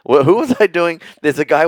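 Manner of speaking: ordinary talk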